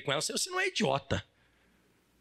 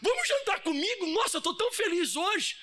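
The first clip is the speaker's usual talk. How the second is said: bubbly invitation; 7 semitones higher than usual. Retold or imitated. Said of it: "Será que vai jantar?".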